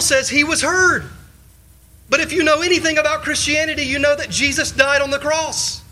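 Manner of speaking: high, urgent pulpit voice; rising fervent sermon; impassioned preaching. Acted. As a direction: press the puzzle on the hearers.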